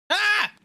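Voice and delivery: high sharp cry of fright; startled yelp. Real, not acted. Caught off guard by the game, a high yelp. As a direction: yelp at the sudden scare. Sudden scare while playing.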